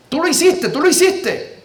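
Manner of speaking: put-on high childlike voice repeating the charge; lightly voiced little girl pointing a finger; girl accusing. Translating the girl's accusation for the congregation, high childish tone.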